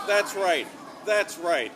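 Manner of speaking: ordinary talk, nothing marked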